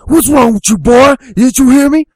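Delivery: high, loud hillbilly shout; angry hillbilly dad. Acted. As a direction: explode at the boy at full volume. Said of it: "the old hillbilly dad's like".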